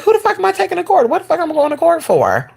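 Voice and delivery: high, hurried baffled squeal; incredulous questioning. Retold or imitated. Storyteller wonders why she'd be going to court, incredulous. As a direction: demand answers in a high, disbelieving voice.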